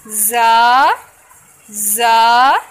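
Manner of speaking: जा said short and loud, not lengthened; loud clipped जा; short letter. Shown as a demonstration. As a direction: keep जा short, one alif. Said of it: "जा को हमने क्यों नहीं लंबा किया".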